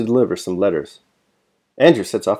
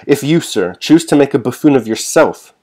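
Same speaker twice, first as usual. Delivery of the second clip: sharp, louder and higher rebuke; 5 semitones higher, 6 dB louder than usual. A narrator's reading. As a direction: cut him down with a tight jaw. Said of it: "he said sharply".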